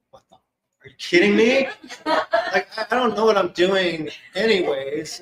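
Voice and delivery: laughing, high and incredulous; laughing disbelief. Real, not acted. Telling the listener about a client wanting past lives, laughing disbelief.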